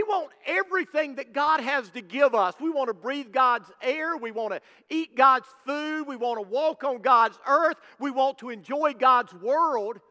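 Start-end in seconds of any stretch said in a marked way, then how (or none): none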